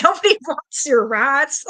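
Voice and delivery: loud, high, drawn-out teasing quote; laughing imitation of a friend. Streamer quoting Teresa's jab about the rats, laughing. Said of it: "She's like".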